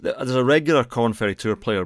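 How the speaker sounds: ordinary talk, nothing marked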